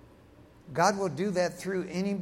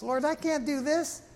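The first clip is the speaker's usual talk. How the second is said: high, put-on plea of helplessness; mock reluctant protest; protesting inability; 9 semitones higher than usual. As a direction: plead out of it in a high voice. Voicing Gideon's reluctance to God.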